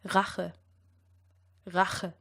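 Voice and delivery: "Rache" with uvular R and throaty ch; German R. Shown as a demonstration. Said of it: "Have a go at the first word".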